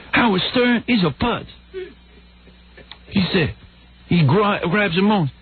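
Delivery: high, put-on voice of an old-time host; Soupy impression. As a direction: grumble about a rival in caricature. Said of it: "like that".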